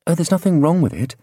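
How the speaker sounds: put-on high, light young woman voice; Farrelyn's voice. A narrator's reading. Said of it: "Farrelyn told her".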